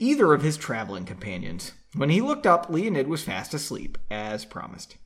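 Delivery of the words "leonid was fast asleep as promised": ordinary delivery, nothing marked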